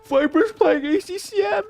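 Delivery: a fan who can't contain himself; thrilled squeal. Real, not acted. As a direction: ecstatic high-pitched outburst. Thrilled at news from chat.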